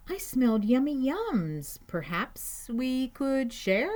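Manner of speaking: hopeful high-pitched animal character; eager badger voice. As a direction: pipe up high, drawing out the tasty words. Badger sniffs food, asks to share.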